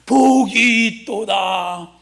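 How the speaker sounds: slow, stretched preacher's declaration; drawn-out proclamation. Shown as a demonstration. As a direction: model it for the congregation, drawing out every word. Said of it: "여기는 나처럼 좀 해".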